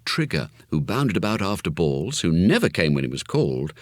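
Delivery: ordinary delivery, nothing marked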